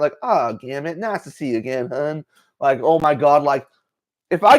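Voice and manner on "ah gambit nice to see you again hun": put-on southern accent. Rogue greeting Gambit when they meet again, Southern accent. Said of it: "Rogue using her southern, southern accent".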